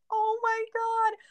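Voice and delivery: put-on high, slow kid voice; mock kid. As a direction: play the kid soaking up the fuss. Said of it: "they're like".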